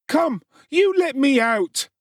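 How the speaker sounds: put-on high, slow child's plea; a small boy begging to be let out; frightened boy. A narrator's reading. Oliver begging Mr Bumble from the cellar, high plea.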